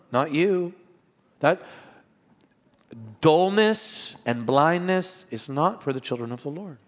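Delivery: rising, ringing preacher's call; fervent preaching. Acted. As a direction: press the point home to the congregation. Preaching the text with force.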